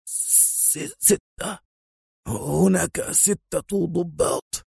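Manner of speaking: high, soft, stammering and frightened; choked voice. A narrator's reading. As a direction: choke the answer out slowly, in fear. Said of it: "تحشرج صوت المفتش وهو يجيب".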